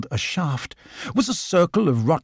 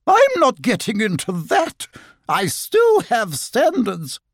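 much higher, haughty and slow on the end; prissy otter; 9 semitones higher than usual. Acted. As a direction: turn your nose up at the water. Lottie voice, high.

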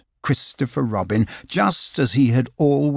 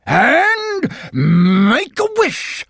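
bouncy Tigger; 13 semitones higher, 4 dB louder, much slower than usual. Acted. Tigger voiced in story.

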